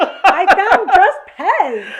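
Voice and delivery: very loud, high, drawn-out announcement; triumphant excitement. Real, not acted. Friend revealing the gift she found, triumphant. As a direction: crow loudly over your find.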